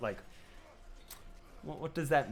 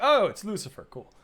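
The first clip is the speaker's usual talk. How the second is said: excited; 8 semitones higher, 8 dB louder than usual. Retold or imitated. Excited quote of own reaction.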